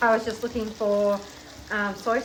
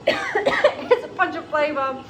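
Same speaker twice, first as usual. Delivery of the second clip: laughing; 7 semitones higher, 7 dB louder, much slower than usual. Real, not acted. Laughing after a cough.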